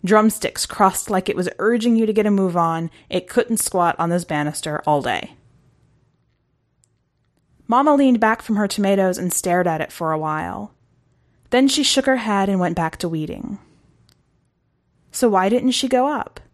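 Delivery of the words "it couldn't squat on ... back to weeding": plain reading voice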